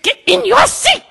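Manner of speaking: very high, loud shriek; Helga. Retold or imitated. Supervisor sending agent back to seat, furious. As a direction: shriek the order, imitating the furious boss.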